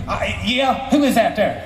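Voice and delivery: put-on high-pitched suspicious old-timer. Musician questioning the visitor through the door, suspicious. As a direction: answer warily in a high caricature. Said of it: "[name], is that you?".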